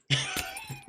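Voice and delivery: short high laugh. Real, not acted. Laughing at quiet chat.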